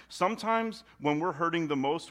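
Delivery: usual speaking voice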